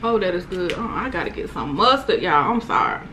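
normal talking voice

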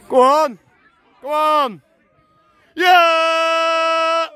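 rising loud cheer; excited cheering. Real, not acted. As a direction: roar them forward. Cheering an attack.

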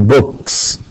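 slow, careful books; drawn-out example plural; slow model word. Shown as a demonstration. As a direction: say it slowly for the class. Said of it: "in the plural form".

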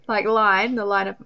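normal talking voice

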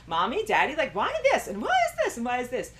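put-on high, loud little-kid whine; little kid's voice. Joking in a kid voice to mommy and daddy. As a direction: play a small child pestering. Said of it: "in my little kid's voice".

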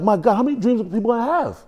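high-pitched incredulous asking; mock complaint up to heaven, pitched high; exasperated question. Mock exasperated reaction to God.

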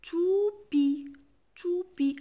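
teacher revealing the word to the class; proud reveal. Shown as a demonstration. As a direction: announce the answer "toupie" brightly.

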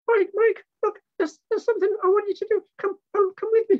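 very high, urgent monkey puppet voice; Mickey's urgent squeak. Acted. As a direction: squeak insistently to drag someone along. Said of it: "So Mickey came, rushing up to me".

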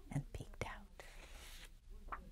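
slow hushed whisper. A narrator's reading. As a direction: breathe it out very slowly.

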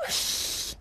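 breathy imitated rush of a sled; long airy whooshing noise. Imitates sled rushing downhill.

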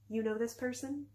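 soft, doubtful question. A narrator's reading. Alienora asking her friend about the stranger, doubtful. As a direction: ask it softly and uncertainly. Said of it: "Alienora's tone was doubtful".

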